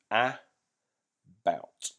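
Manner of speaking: about split slowly into a-bout; slow syllables. Shown as a demonstration. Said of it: "say it slower and break it into syllables".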